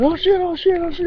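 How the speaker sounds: very high-pitched, rapid mock panic; mock panicked yelling. Mimicking the man who fled, high panicked yelp. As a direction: squeal it out like someone fleeing in terror. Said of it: "he started cussing and yelling".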